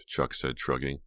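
plain reading voice

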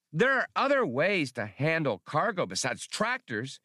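high-pitched robotic droid voice; chirpy droid speaking through a vocoder. Acted. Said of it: "said a high-pitched vocoder".

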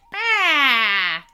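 long, loud falling "Baaah"; acted roar of frustration, dragged out; enraged yell. A narrator's reading. Imp hops about with rage, yelling. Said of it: "he yelled".

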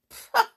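high loud scoff; scornful pah. Acted. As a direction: spit out the scorn. Scornful exclamation.

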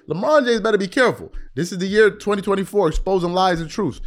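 ordinary talk

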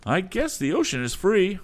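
high, loud cocky voice; rascally agent. Acted. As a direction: play the smug rascal. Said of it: "the rascally agent".

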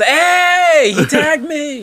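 put-on high, playful child call. Kids playing outside a funeral, high playful call. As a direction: call out like a kid at play. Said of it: "we just".

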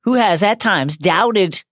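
hammed-up solemn proclamation, slowed; mock announcer. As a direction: declare it like a grand dedication. Mock proclamation continuing bit.

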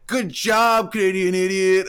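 high, sarcastic jab at a stranger; mocking taunt. Mocking a stranger who trips on the street, sarcastic. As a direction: tease someone who just tripped. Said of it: "you're like".

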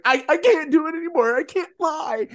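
high, wavering mock-tearful breakdown; imitating crying. Rion breaking down in front of the in-laws, mock tearful and high. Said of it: "And she's just crying".

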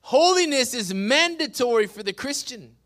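ringing, emphatic declaration; forceful preaching. Real, not acted. Pastor repeating the rule to Christians, proclaiming. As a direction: proclaim it out to the room.

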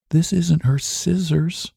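a timid, shrinking voice over the box. Acted. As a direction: shrink away from the words. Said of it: "in a shrinking voice".

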